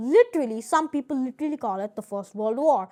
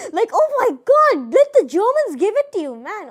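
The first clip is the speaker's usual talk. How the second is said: loud, high-pitched exasperation; exasperated outburst; 9 semitones higher, 11 dB louder than usual. Real, not acted. Ranting about the French in Quebec, loud and exasperated. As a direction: blurt it out at full volume, way up high.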